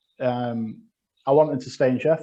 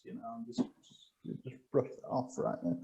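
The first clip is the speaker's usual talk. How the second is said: mock-modest murmur; 3 semitones lower, 15 dB quieter than usual. Mock brushing off a brag.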